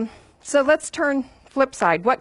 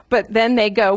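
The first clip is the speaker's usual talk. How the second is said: ordinary talk, nothing marked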